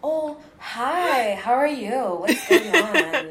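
mock-friendly high voice, drawn out at the end; softened code-switched voice. Shown as a demonstration. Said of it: "add a bit of softness".

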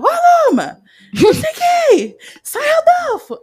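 loud, high-pitched call across a room; mock yelling to get someone's attention; demonstrating a yell. Shown as a demonstration. Hearing person calling out for someone's attention, shouting. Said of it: "a gente, a gente grita".

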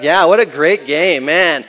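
loud, slow, thrilled outburst; excited. Real, not acted. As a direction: relive the big win loudly. Recalling the Beavers' win.

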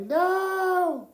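very high, loud wail; hammed-up scream of dismay; mock anguished cry. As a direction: wail it out in exaggerated heartbreak. His reaction to the sad scene.

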